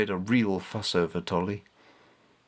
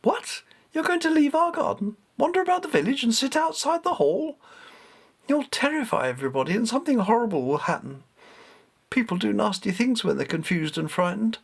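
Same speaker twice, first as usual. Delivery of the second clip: put-on much higher, panicked boy's voice; a frightened boy pleading with the cat; alarmed boy; 11 semitones higher than usual. A narrator's reading. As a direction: blurt it out in startled alarm. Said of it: "Richard sat bolt upright in alarm".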